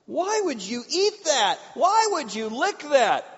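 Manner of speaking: high, loud, slow why; incredulous disgust. Retold or imitated. Preacher asks why eat vomit, high loud disbelief.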